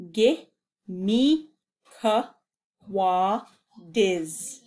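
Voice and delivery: Ge, mi, ka, wa, diz split with pauses; syllable by syllable. Shown as a demonstration. Teacher splitting the word for learners to repeat. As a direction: beat out Ge, mi, ka, wa, diz. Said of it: "Wait, when you're done".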